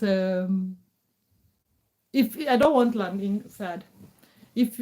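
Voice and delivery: ordinary talk, nothing marked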